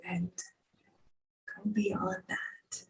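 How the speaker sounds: slow whispered invitation. Real, not acted. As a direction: whisper it slowly.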